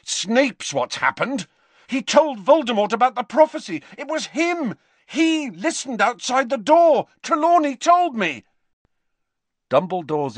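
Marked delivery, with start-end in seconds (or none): 0.0-8.5 put-on high, loud angry boy's rant; Harry accusing Snape in a rising rage; furious accusation. A narrator's reading. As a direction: pour out the fury at Dumbledore. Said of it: "ignited Harry's fury".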